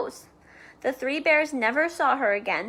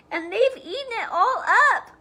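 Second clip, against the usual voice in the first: put-on high, louder whiny baby bear; wee small voice; 10 semitones higher, 5 dB louder than usual. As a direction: wail it like a tiny upset cub. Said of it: "in his wee small voice".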